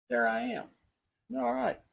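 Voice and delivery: relieved little discovery, voice jumping up; pleased surprise. Real, not acted. Finally spots himself live.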